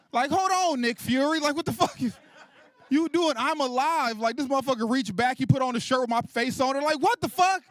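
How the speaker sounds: high, loud comic rant; incredulous outrage. Acted. Wounded man berating friend who offers to avenge him, incredulous outrage.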